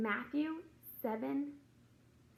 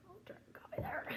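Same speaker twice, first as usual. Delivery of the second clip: quick breathy aside to herself; hushed note while grabbing the coffee; whispered aside; 10 semitones higher, 8 dB quieter than usual. Real, not acted. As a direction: whisper it under your breath before sipping. Aside while taking a sip.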